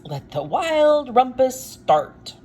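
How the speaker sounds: high, loud, slow royal command; cried out. Acted. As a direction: Max shouts to start the party. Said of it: "cried Max".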